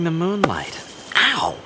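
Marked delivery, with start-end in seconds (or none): 1.1-1.6 high whispered ow; vampire stung in the fight, hissing ow; pained yelp. Acted. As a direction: yelp under your breath in pain. Vampire hurt during scuffle.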